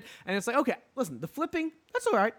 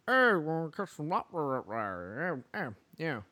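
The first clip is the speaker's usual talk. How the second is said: Maine mumble; 2 semitones lower than usual. Retold or imitated. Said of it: "low mumbling".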